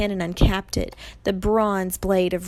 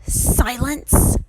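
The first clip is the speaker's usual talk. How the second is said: loud, raised snarling command; angry snarl; 5 semitones higher, 6 dB louder than usual. A narrator's reading. Medusa silences Annabeth with a loud snarl. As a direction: snarl to cut someone off. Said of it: "Medusa snarled".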